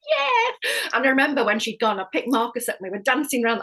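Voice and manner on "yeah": a very high, loud yeah; thrilled yeah. Retold or imitated. Mother catching the slipped word, thrilled yeah. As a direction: squeal it with delight. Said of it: "And I was like".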